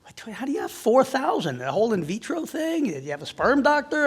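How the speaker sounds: incredulous. Retold or imitated. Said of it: "They're like".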